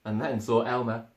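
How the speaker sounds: usual speaking voice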